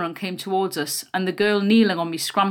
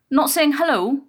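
higher, louder challenge; demanding; 7 semitones higher, 5 dB louder than usual. A narrator's reading. Bully confronting a child who walked past, demanding. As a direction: demand it with a pointed rising question. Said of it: "she demanded".

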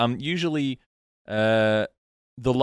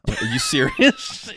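incredulous; 4 dB louder, much slower than usual. Real, not acted. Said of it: "reacting to bad reading".

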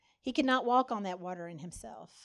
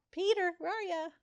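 calling out; 9 semitones higher than usual. Retold or imitated. Preacher calling out for a missing Peter. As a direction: call for him like you can't find him.

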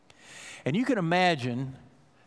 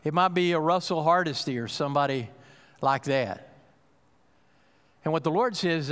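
ordinary delivery

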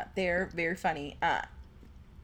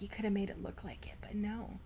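whisper; 11 dB quieter than usual. Retold or imitated. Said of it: "I'm like".

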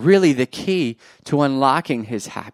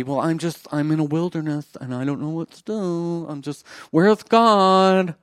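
hammed-up helpless moaning, higher than usual; mock lost believer; 4 semitones higher than usual. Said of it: "I don't know if they talk like that".

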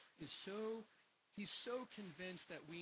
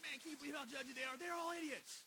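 high, fast, louder scoffing; mocking younger self; 8 semitones higher, 5 dB louder than usual. Preacher mocking his younger self scoffing at church people. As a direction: scoff at everyone. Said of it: "but I was like".

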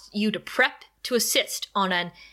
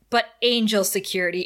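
ordinary delivery

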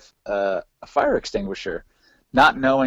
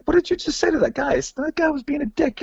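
high-pitched, animated back-and-forth; mock bewildered retelling; retold incredulous exchange. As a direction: replay the argument with your pitch way up. Retelling the argument with Tim.

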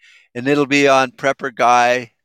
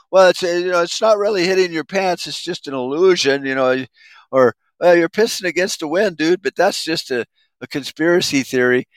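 mocking a Facebook know-it-all; 6 semitones higher than usual. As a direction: sneer it like a smug online commenter. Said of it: "somebody on Facebook could go".

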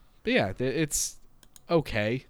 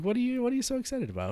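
put-on much higher, fast, baby-talk voice to the cat; mock pet talk; 12 semitones higher, 3 dB quieter, faster than usual. Owner to zooming cat at dawn, fast high pet-talk voice. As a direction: coo at the frantic cat, high and quick.

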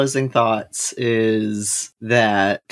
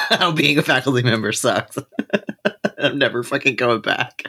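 laughing; 2 semitones higher than usual. Real, not acted. Mock TED talk sign-off.

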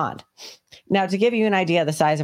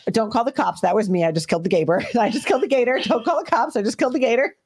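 giddy announcement; 5 semitones higher, faster than usual. Retold or imitated. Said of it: "and I was like".

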